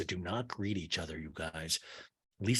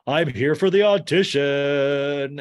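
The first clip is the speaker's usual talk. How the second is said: loud, drawn-out theatrical announcement; hammed-up diva arrival; grand entrance; 5 semitones higher, 8 dB louder, slower than usual. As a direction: announce yourself big and high, stretching the words. Acts out Lisa's grand entrance.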